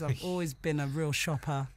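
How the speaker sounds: usual speaking voice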